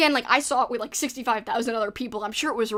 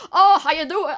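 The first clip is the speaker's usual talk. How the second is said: put-on loud, higher-pitched phony movie New Orleans accent; fake New Orleans accent; 6 semitones higher, 8 dB louder than usual. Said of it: "fake, false New Orleans accent in movies".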